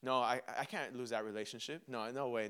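low, soft, hurried refusal; quiet denial. Acted. Person refusing to lose a relationship, quiet denial. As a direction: deny it quickly under your breath. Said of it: "you s-, first deny it".

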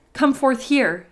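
astonished shout, mildly acted; amazed cry. A narrator's reading. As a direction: call out in amazement. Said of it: "he cried in amazement".

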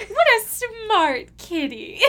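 high, drawn-out cooing at a cat; exaggerated, high-pitched purr of praise; mock-sweet praise. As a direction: gush slowly and loudly, laughing through it. Quoting film line, impressed host.

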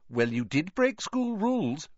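high-pitched, told-you-so delivery; reproachful. A narrator's reading. Hermione's look at Harry and Ron, reproachful. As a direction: say it like a prim reproach. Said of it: "sort of expression".

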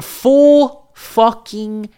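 FULL FUCKING drawn out high and loud; loud, slow, high punch on "full fucking"; heavy emphasis. Real, not acted. Stressing how full the drink was.

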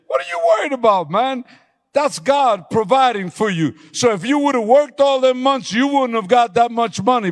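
high, loud, driving sermon climax; full-force preaching. Acted. Pastor shouting his vow to God to the church, high and loud.

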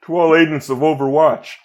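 Winston voice gone Obama. Acted. Friend reads Winston lines for impression game, breaking into laughs. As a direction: try Winston but slide into Obama cadence, giggling. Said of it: "I went very Barack Obama".

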